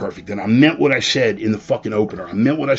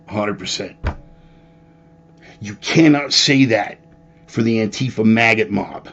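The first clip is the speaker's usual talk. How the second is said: normal talking voice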